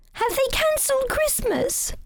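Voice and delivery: put-on high, anxious child voice; worried little rabbit. A narrator's reading. Young rabbit pleading with wood elf, high and alarmed. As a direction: ask it in alarm, rising. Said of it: "said Barnaby".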